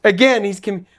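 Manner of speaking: normal talking voice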